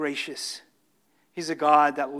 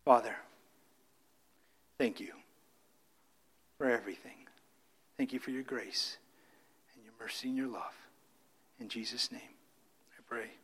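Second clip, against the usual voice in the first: low, worn-out prayer voice; losing his voice; 7 semitones lower, 9 dB quieter than usual. Real, not acted. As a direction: pray with a voice nearly gone. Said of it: "and I'm losing my voice".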